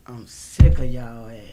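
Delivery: muttered under breath, unvoiced; whispered aside. Real, not acted. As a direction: whisper it to yourself. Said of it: "Did I say that?".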